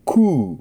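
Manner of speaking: クー held on a rounded ウ; long ウ. Shown as a demonstration. Teacher isolating the ウ vowel of qui. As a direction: hold the ウ of クー. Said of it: "まずウの音があります".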